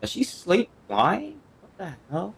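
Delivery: normal talking voice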